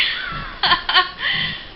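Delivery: a bright rising laugh; high laugh. Real, not acted. Woman laughing at her own predator talk, high and rising.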